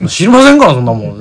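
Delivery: loud, high exasperated brush-off; mock indignant shout. Flustered staff shouting at callers about oinari. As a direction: snap back at a nosy caller. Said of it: "っつって".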